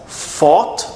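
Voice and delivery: "Fought" with F, tongue kept behind the teeth; F sound. Shown as a demonstration. Teacher contrasts F with TH, "Fought" on a clear F. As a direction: start "Fought" on lip-to-teeth F. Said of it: "o F não tem a língua entre os dentes".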